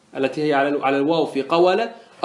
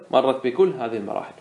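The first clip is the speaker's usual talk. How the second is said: usual speaking voice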